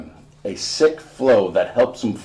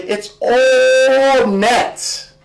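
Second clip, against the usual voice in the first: much higher, slow and loud, stretched out; drawn-out cheer; 7 dB louder, much slower than usual. Acted. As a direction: sing out the praise like a proud mom. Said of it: "Mom says".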